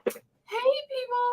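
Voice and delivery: high mock cheery hello; bubbly greeting. Friend walks in greeting the office, mimicked high and cheery. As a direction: play her sunny greeting to the room. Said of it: "Felicia was like".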